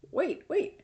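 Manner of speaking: sudden high wait wait; surprised. Retold or imitated. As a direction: stop her, startled. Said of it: "and I was like".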